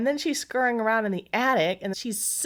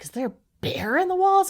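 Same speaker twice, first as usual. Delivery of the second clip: alarmed; 10 semitones higher, faster than usual. Retold or imitated. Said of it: "he was like".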